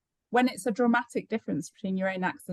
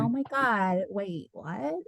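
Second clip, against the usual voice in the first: put-on California accent; 5 dB quieter, much slower than usual.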